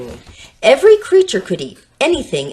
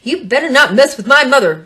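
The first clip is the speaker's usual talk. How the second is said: higher, louder threat; defensive warning; 6 semitones higher, 6 dB louder than usual. Acted. Dinky defending his mother to the taunter, louder higher warning. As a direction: warn him off with hackles up. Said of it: "said Dinky".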